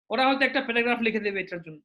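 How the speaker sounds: usual speaking voice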